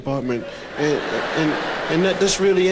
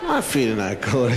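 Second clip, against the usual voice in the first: usual speaking voice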